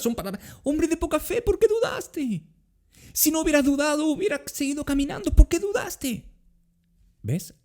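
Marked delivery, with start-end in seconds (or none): 0.6-6.3 much higher, gentle loving voice; preacher showing Jesus's words to Pedro as loving, not harsh; tender, affectionate. Shown as a demonstration. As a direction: say it like a caring friend, not a scold. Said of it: "Palabras cariñosas, no son palabras duras".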